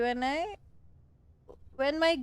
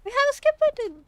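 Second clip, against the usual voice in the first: mock announcement; 14 semitones higher, 12 dB louder than usual. Mock announcing the skip button.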